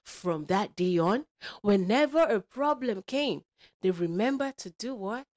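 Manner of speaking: put-on animated, emphatic storyteller for kids; lively read-aloud to young listeners; children's storytelling. A narrator's reading. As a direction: drive the story along with bold swoops. Tells a children's story with character voices.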